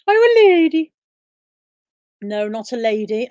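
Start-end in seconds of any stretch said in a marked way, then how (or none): 0.1-0.9 mock high voice. Repeats question higher, louder.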